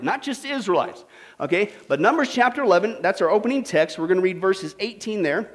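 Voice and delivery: ordinary talk, nothing marked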